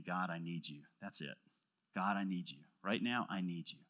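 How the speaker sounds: hushed, humble plea to God; humble hushed prayer. Pretend. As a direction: confess it quietly, like a plea in the dark.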